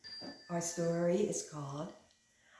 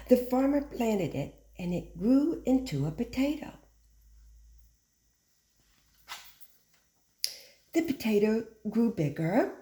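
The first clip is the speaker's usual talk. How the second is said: put-on bright, lively read-aloud to children; storytime reading; 3 semitones higher than usual. A narrator's reading. Teacher reading the tale to her class, lively storytime.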